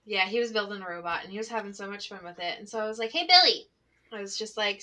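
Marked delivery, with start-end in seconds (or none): 3.1-3.7 raised, louder call. Retold or imitated. Teacher getting the attention of her young pupil, calling out.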